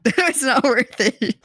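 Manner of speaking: words squeezed out slowly through laughter; laughing. Real, not acted. Streamer reacting to talk of therapy, laughing. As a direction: laugh your way through the phrase. Laughs while giving her opinion.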